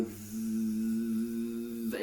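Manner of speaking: th- held and buzzing like a little mosquito. Shown as a demonstration. Teacher demonstrating the sound to class, a mosquito-like th. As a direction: hiss the th like a mosquito. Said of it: "like a little mosquito".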